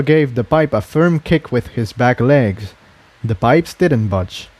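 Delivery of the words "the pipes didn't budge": plain reading voice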